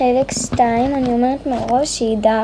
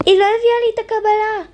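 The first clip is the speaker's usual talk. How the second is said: mock indignant complaint; 9 semitones higher, faster than usual. Customer complains to manager.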